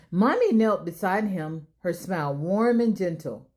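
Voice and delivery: ordinary delivery, nothing marked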